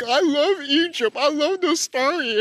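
high, slow, dopey imitation; mock enthusiasm. Imitating mom's boyfriend loving a story, mocking. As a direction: draw it out like a gushing simpleton.

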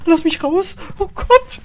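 high, loud panicked yell; panicked scream. Real, not acted. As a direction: scream for your life while fleeing. Fleeing ghost, panicked shout.

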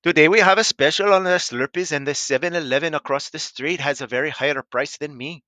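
cartoon Indian accent, pitched like a store clerk's pitch; Simpsons Indian accent. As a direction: sell the Slurpee special in a cartoon Indian accent. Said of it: "voice from The Simpsons".

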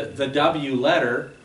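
ordinary talk, nothing marked

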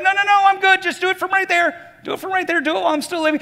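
high, loud comic protest; frantic mock refusal. Driver refusing to pull over for the mechanic, frantic mock protest. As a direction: wave off help in a high-pitched panic.

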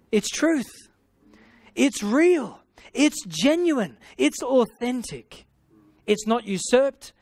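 slow, high, ringing declarations, word by word; emphatic preaching. Acted. Pastor preaching to the congregation, slow and emphatic. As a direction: proclaim each quality slowly and high.

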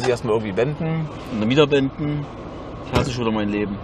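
low, soft despairing mumble; resigned mutter. Real, not acted. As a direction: mutter it like you've given up. Resigniert gemurmelt. (resigniertes Murmeln)

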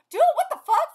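high, very loud disbelief; loud incredulous reaction; stunned outburst. Real, not acted. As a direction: burst out in shock. Stunned reaction while reading.

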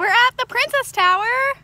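excited. Real, not acted. Mom echoes with excitement.

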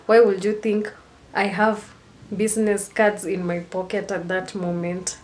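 normal talking voice

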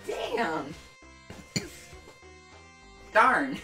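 plain reading voice

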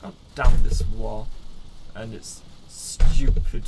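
loud slow angry shout; yelling. Acted. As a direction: bellow it furiously. Yelling in game.

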